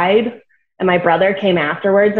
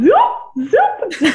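scooter noise; 12 semitones higher than usual. Retold or imitated. Imitating scooter sound.

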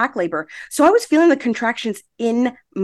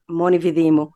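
mother's Calabrese saying, pitched lower; Calabrese Italian; 6 semitones lower than usual. Retold or imitated. Daughter quoting her mom's warning, in low Calabrese Italian. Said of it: "in Italian, she would say".

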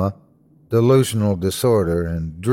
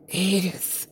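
soft, higher-pitched groan; 8 semitones higher, 8 dB quieter than usual. A narrator's reading. Waking patient calls for Edith, groaning softly. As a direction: groan the name out weakly and softly. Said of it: "I groaned".

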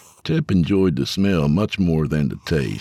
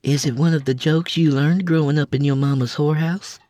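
dry teasing woman; 11 semitones higher, faster than usual. A narrator's reading. Lila teasing Abel.

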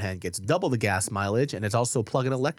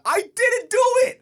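much higher, louder, slow squeaky denial; mock innocent; 7 dB louder, slower than usual. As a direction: deny it in a hammed-up innocent squeak. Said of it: "I was like".

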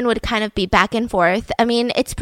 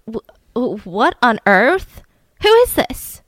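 stammering shock. Retold or imitated. Wife finds husband at the door with another woman, acted stammering shock.

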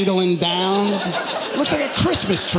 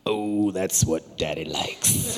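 low, slow, softer purr; lecherous daddy; 9 semitones lower, 5 dB quieter, much slower than usual. Pretend. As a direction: purr it slowly, pitched way down. Leering remark about his girl.

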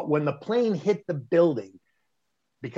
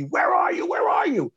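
high, loud frantic yell; mock panicked shout; retold scream; 14 semitones higher, 4 dB louder than usual. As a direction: imitate her screaming where he is. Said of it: "she starts screaming at me".

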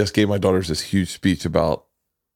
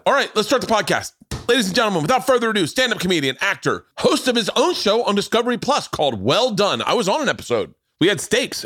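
high, rousing guest introduction; show announcer; 11 semitones higher than usual. Real, not acted. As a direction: announce the comedian like a live emcee.

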